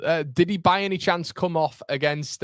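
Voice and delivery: ordinary delivery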